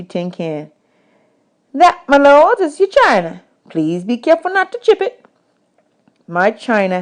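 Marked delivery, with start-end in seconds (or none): 1.7-5.2 higher, mock-grand woman's voice; woman at camp. Camp woman teasing boys about their tin china, higher. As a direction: serve it like fine china, teasing.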